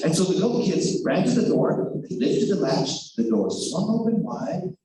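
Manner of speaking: plain reading voice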